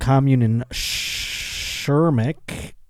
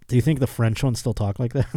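ordinary delivery

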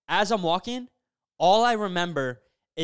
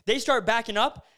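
ordinary delivery

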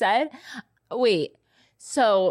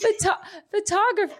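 higher, laughing as you test "photographer"; 11 semitones higher than usual. Real, not acted. Host testing her own accent on "photographer", laughing. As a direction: try the word through helpless giggles. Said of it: "is that an accent".

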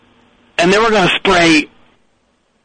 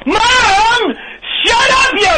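high, furious screech; piercing teenage tantrum yell; screaming at mom; much slower than usual. Acted. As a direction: shriek it upstairs like a bratty kid.

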